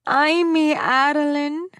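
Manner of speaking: put-on high, loud, slow Spanish-accented cry; bellowed. A narrator's reading. Mother on the phone bellowing her daughter's name. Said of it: "was immediately bellowed".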